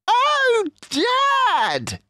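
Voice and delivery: put-on very high, loud young fox crying out; excited cry. Smallest fox cries out to his dad, thrilled. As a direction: squeal it with delight. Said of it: "he cried out".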